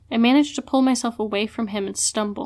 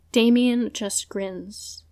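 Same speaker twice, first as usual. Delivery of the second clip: ordinary delivery, nothing marked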